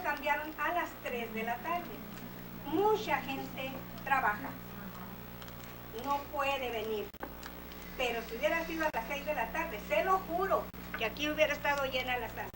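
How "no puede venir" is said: ordinary talk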